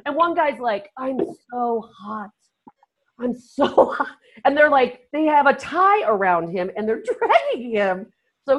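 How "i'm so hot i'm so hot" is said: drawn-out, imitated overheated moan; mock exhausted complaint. Racer struggling in the heat, mock exhausted. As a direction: act wiped out by the heat.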